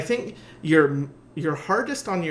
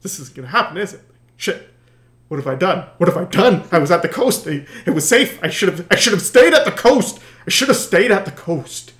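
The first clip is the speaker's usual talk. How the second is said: rising, louder despairing cries; hammed-up dread, getting louder; mock panic; 8 dB louder than usual. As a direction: play a lost sailor losing it. Lost rower panicking at sea.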